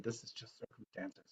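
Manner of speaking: soft breathy whisper; whispered inner voice. Retold or imitated. Inner voice whispering to herself. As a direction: whisper it like a voice inside your head. Little voice inside said.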